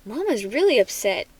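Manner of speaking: plain reading voice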